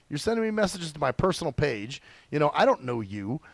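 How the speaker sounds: usual speaking voice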